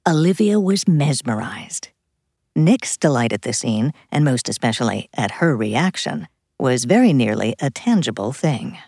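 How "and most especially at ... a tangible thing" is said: ordinary delivery, nothing marked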